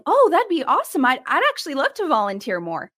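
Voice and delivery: put-on a naive young volunteer gushing with delight; imitating a trusting volunteer, lightly excited; innocent eager yes. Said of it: "you innocently are going".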